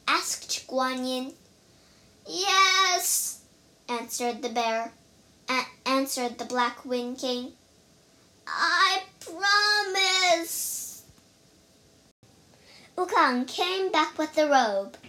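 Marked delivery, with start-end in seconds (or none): 2.3-3.4 high, stretched-out yes; whimpered giving in; pained surrender. A narrator's reading. Bear gives in, in pain. 8.5-11.0 high, very slow, drawn-out promise; pained surrender. A narrator's reading. Bear gives in after the spell.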